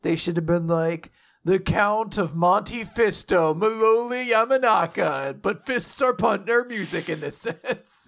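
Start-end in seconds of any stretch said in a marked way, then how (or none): 1.4-8.1 mock grand villain intro.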